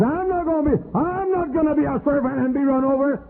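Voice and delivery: very high, mocking voice of a proud refuser; mock refusal. Preacher mocking those who refuse to serve, high and whiny. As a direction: squeak it up high like a whiny holdout.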